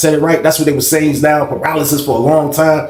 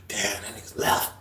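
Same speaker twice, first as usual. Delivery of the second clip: whisper; 5 semitones lower, 14 dB quieter than usual. Retold or imitated. Whispering as Shane leaves.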